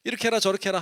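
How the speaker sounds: hammed-up order from a nagging elder; bossy elder. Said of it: "가르치려 하지 마세요". (훈계하는 어른 흉내, 크게)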